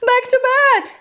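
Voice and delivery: high, loud storybook yell; excited yell. A narrator's reading. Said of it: "yelled Fred".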